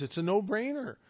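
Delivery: normal talking voice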